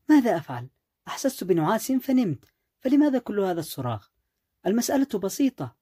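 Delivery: high-pitched, whiny protest; indignant retort. A narrator's reading. Wife defends her nap to her shouting husband, indignant retort. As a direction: push back at the yelling, pitched high.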